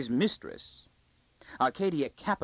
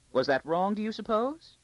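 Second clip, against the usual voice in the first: high worried question; fretful lady; 10 semitones higher than usual. A narrator's reading. Court lady wondering if gift was wrong, fretful. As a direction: ask anxiously in a high lady's voice.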